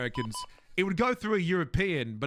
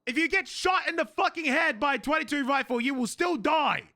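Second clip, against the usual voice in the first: high, insistent rant; heated, raised-voice protest; exasperated; 8 semitones higher than usual. Real, not acted. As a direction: hammer the point home in disbelief. Exasperated rant about small guns.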